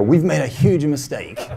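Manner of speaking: hammed-up cry of regret; mock dismay. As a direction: wail it in comic horror. Retold regret over dark floor.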